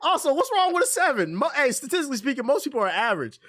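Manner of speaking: high, laughing disbelief; incredulous laughing rant. Real, not acted. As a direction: go way up in disbelief and keep pushing.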